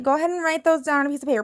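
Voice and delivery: usual speaking voice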